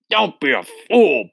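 high, loud, exasperated rebuke; scolding. A narrator's reading. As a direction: scold the pupil with force. Headmistress scolds the boy.

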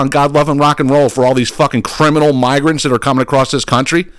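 ordinary talk, nothing marked

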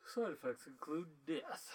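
slow, grave ad-voice disclaimer; deadpan drug commercial voice; cheerful ad with eerie menace. Pretend.

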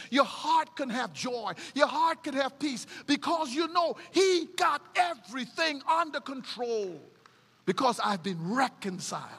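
rising preached promise; preacher reassuring the congregation; preaching. Acted. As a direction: swell the promise of peace.

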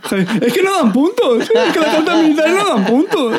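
talking through laughter; laughing. Real, not acted. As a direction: laugh while protesting. Laughing at friend's losses.

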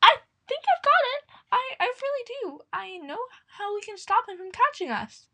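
very high, excited little squeak; a tiny mouse bursting with an idea; small voice. Acted. Little mouse pipes up from the back, high and eager. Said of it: "a small voice popped up".

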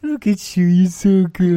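put-on high, loud baby talk; puppy talk. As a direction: praise her like a good puppy. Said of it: "it's like I'm a puppy".

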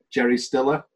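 ordinary delivery

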